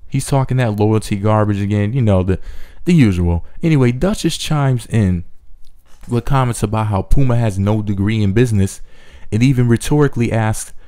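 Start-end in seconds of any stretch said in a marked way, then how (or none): none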